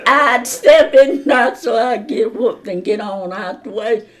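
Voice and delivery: laughing while talking. Real, not acted. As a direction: laugh through the words. Laughing at fight memory.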